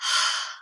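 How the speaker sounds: an open-mouthed exhale through the mouth; mouth-only breath out. Shown as a demonstration. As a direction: let the breath flow out of the mouth. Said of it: "If I go like this".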